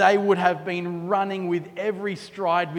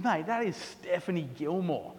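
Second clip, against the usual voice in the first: hushed aside; 8 dB quieter than usual. Retold or imitated. Telling mate who the surfer is.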